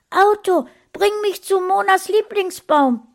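high, loud command to the car; shouting. A narrator's reading. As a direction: shout urgently at the flying car. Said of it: "schrie Sascha". (hoher, lauter Befehlsruf)

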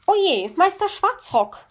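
high, loud startled cry. A narrator's reading. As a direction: caught in the act, startled. Magpie caught by the raven. (erschrockener Ausruf)

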